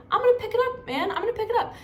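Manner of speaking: high-pitched, exasperated mock protest. Acting out her protest over dropped litter.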